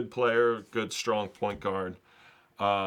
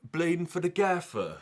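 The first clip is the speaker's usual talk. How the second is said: high-pitched attempt at a British accent; hammed-up British football slang accent; 7 semitones higher than usual. Shown as a demonstration. Ending his demo for viewers, British accent. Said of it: "that's my best British accent".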